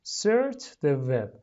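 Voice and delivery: very slow, loud, clear teacher model; slow model phrase. Shown as a demonstration. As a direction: draw it out for students to repeat. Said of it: "لطفاً تکرار کنید".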